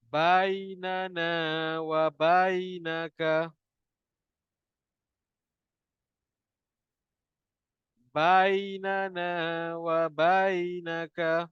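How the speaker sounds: very slow drawn-out Quran recitation. Shown as a demonstration. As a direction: recite slowly for students to repeat. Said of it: "ikut saya baca".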